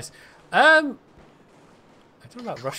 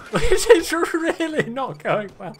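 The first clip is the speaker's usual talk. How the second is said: exasperated laugh; 13 semitones higher, 8 dB louder, slower than usual. Real, not acted. Heist collapsing, laughing.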